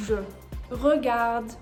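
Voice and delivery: Je and Regarde said clearly; French pronunciation. Shown as a demonstration. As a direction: model Je and Regarde. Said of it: "no accent marks".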